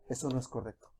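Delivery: susurro suave y mucho más bajo; voz suave de Dios. Acted. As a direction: como un silbo apacible que advierte con ternura. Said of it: "viene un silbo apacible". (susurro apacible, muy bajo)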